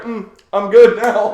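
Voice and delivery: loud, high, drawn-out mock pride; proud peacock. Child comes walking out to the adults, proud as a peacock. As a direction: strut it out like a pleased kid. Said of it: "proud peacock".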